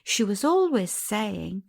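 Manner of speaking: ordinary delivery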